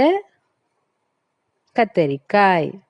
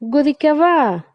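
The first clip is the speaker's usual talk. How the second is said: brinjal's voice; 7 semitones higher than usual. Acted. Brinjal offers to jump for the boy, high cartoon voice. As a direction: bounce the offer brightly. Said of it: "கத்திரிக்காய்".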